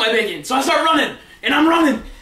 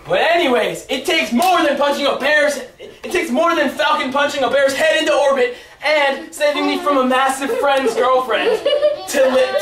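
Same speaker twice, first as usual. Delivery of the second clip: ordinary talk, nothing marked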